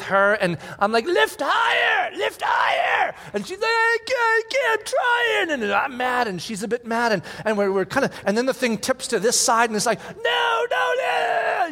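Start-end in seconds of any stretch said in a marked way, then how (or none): 1.0-3.1 a dad shouting orders at his daughter under a heavy load; frustrated yelling. Retold or imitated. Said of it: "so sorry about the screaming". 3.7-5.6 struggling protest. Retold or imitated. Daughter straining under the trampoline. 10.2-11.7 panicked shout. Retold or imitated. Trampoline tipping the wrong way.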